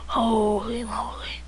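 slow, whispered soldier with barely any voice; throat-damaged soldier. Acted. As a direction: whisper it as if the throat were cut. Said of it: "can barely talk".

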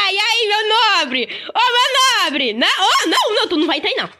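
loud, high taunting; raging gamer. Real, not acted. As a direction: taunt the mob loudly. Provoca o mob, alto. (provocando alto o mob)

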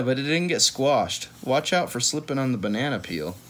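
usual speaking voice

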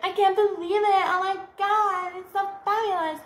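shrieking with mock delight and tearful laughter; squealing girls. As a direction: gush like an overwhelmed girl, half crying. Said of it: "the girls go like".

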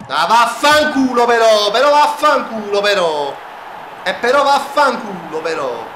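explosive italian cursing. Real, not acted. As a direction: curse the game at full voice. Cursing at the game. (imprecazioni esplosive)

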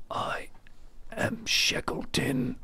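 slow, very high hammed-up announcement; theatrical slow proclamation; dramatic reveal. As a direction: draw it out like a ghostly legend revealing himself. Shackleton's grand reveal.

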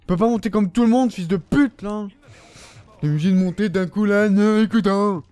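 raging gamer tirade; furious cursing. Real, not acted. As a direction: yell insults at the enemy. Cursing an enemy's movement.

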